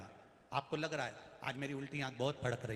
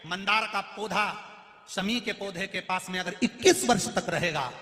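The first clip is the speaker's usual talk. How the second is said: higher, louder sermon delivery; fervent preaching; 7 semitones higher, 7 dB louder than usual. Acted. Preacher proclaiming Ganesh's promise to devotees, fervent sermon. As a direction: preach it to the crowd with fervour.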